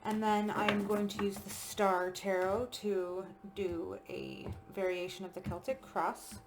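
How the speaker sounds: usual speaking voice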